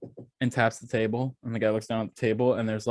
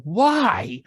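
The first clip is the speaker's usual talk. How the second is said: high, loud, drawn-out protest; retold cry of disbelief; exasperated why; 6 semitones higher, 5 dB louder than usual. Asking the phone agent why, exasperated.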